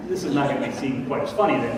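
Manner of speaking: usual speaking voice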